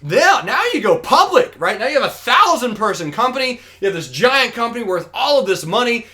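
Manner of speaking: high, loud climax of a salesman's pitch; acted big excitement. Businessman promising fisherman riches, acted big excitement. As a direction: burst out with the grand prize, hammed-up.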